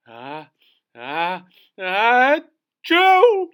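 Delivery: ah ah building up to a huge achoo; building sneeze. Shown as a demonstration. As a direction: wind up slowly, then explode into a loud sneeze. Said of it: "a noise that sounds like this".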